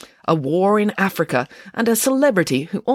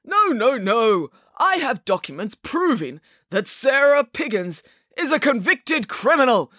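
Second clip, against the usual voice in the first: high-pitched, emphatic accusation; insistent Mister Green; 8 semitones higher, slower than usual. A narrator's reading. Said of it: "began Mister Green".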